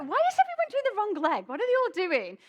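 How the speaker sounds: high, loud, fast bewildered outburst; incredulous, rushed and loud; baffled self-quote. Retold or imitated. Retelling own confused reaction to class.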